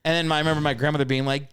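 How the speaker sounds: normal talking voice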